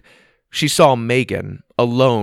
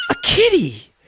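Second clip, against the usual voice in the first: delighted squeal; 3 dB louder than usual. Acted. Megan delighted by kitten.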